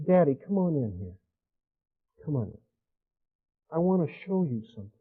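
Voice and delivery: put-on soft, hushed child's invitation; hushed little girl. Daughter inviting father into her world, hushed. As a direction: coax daddy in, barely above a breath.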